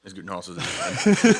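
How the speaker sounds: words through a laugh. Real, not acted. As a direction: say it while laughing. Laughing while speaking.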